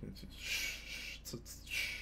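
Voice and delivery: breathy hushed shushing; whispering. Pretend. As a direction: whisper it like a secret. Hushing about secret channel.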